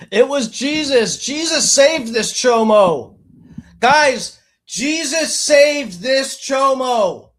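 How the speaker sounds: loud, high mock-evangelist shout; mock preaching. Streamer mocking a man's claim of divine rescue, mock preaching. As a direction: proclaim it like a hammed-up revival preacher.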